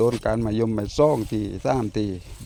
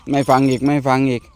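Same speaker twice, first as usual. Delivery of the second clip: loud, higher exasperated scolding; scolding the dog; 4 semitones higher, 9 dB louder than usual. Real, not acted. Owner scolding his disobedient dog, loud exasperated scold. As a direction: let the frustration out loud at the dog.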